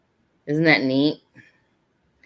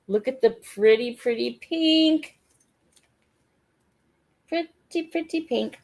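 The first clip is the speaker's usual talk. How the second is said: high sing-song; 9 semitones higher than usual. Real, not acted. Gushing over a pink bag.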